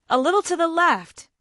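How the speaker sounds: talking through a mouthful of chips; mouth full. Acted. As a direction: say it while chewing. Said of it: "she said, mouthful".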